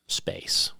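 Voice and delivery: hushed unvoiced whisper. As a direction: breathe the word out in a whisper. Quoting the interviewer's question.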